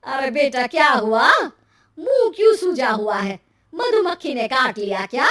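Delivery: mother's startled questions to her son; concerned mother. Acted. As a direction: fuss loudly over your child.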